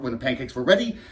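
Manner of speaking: ordinary talk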